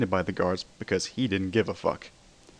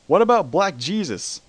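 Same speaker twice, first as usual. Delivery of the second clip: high, loud pitchman hype; Billy Mays sales voice; 9 semitones higher, 6 dB louder than usual. A narrator's reading. Billy Mays urges Spider-Man to escape, pitchman-loud. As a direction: sell it like a TV ad pitchman.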